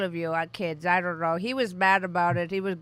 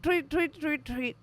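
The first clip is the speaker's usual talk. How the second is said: birdsong imitation; 9 semitones higher, slower than usual. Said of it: "all I heard on your end was tweet".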